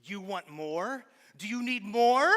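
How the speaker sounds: big hyped-up question to the whole house; rousing the crowd. Real, not acted.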